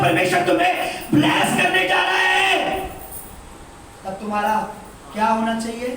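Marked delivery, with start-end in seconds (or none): 0.0-2.6 shouted, raised blessing proclaimed high; loud blessing. Shown as a demonstration. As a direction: pastor calls out the blessing to the congregation, loud. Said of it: "पर जब मैं कहता हूं".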